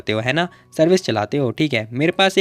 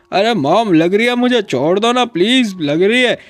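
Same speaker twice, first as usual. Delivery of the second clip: high, loud pained whine, slow on the plea; a son yelping as his ear is twisted; whiny pleading son; 5 semitones higher, 3 dB louder, slower than usual. Acted. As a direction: beg mom to let go of the ear. Son's ear pulled by mom.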